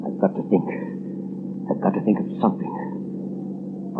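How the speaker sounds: frantic whispered muttering; whispering to himself. Acted. The cornered Sneed whispering to himself, frantic. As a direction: whisper it under your breath in a panic.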